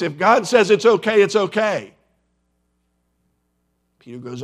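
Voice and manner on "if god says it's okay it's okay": loud, emphatic divine pronouncement; booming voice of God. Retold or imitated. God answering Peter's objection, booming voice. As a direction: boom it out with full authority. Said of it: "in this booming voice".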